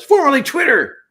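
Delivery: high, very loud heckling shout; sudden high-pitched yell on request; comic yell. Acted. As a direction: lean back and holler it. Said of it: "[name] leans back and yells".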